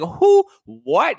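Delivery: high, loud disbelieving shriek; shocked shriek. Retold or imitated. Shocked at overtime loss.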